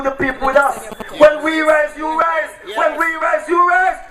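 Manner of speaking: ordinary talk, nothing marked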